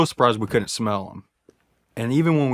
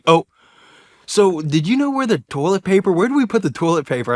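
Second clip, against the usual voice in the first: higher-pitched act of clueless asking; playing dumb; 6 semitones higher than usual. Hunter stalling his buddy while reaching for his phone, playing dumb. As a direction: feign a casual, silly question. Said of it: "acting, like, stupid".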